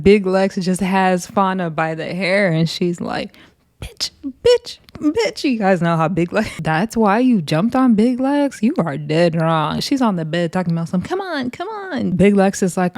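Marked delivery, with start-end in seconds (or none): 3.8-5.4 very high-pitched imitation; mock fight taunt. Imitating fighter holding hair. 11.0-12.1 very high, soft goading; small falsetto taunt; mock taunting. Mimicking taunts from the bed.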